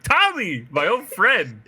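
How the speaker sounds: high, loud, delighted greeting; big cheesy hello; cheesing. Retold or imitated. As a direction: greet a long-lost buddy through a huge grin. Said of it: "he's absolutely cheesing".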